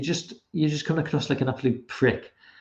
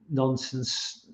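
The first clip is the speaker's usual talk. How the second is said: normal talking voice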